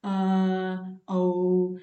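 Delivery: औ loud, lips rounded; rounded vowel demo. Shown as a demonstration. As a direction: shape the lips for औ. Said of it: "होठों की भी हमको आकृति बनाना पड़ता है". (औ को होठ गोल करके ज़ोर से बोलना)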